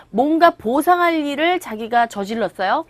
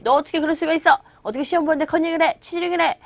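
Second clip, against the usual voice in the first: higher-pitched mock scolding from a teacher; 6 semitones higher, faster than usual. Teacher catches a student cheating on a quiz, mock scolding. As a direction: play a teacher lightly telling off a student. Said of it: "그랬을 때 선생님이".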